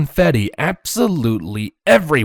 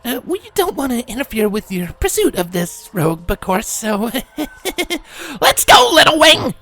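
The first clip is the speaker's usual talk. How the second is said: shaky, scared, then yelling to flee; trembling voice; 13 semitones higher than usual. A narrator's reading. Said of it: "Cirrus said in a trembling voice".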